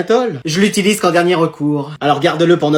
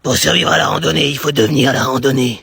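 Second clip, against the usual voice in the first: mock-epic motto; 4 semitones lower than usual. Mother's mock-epic hiking motto.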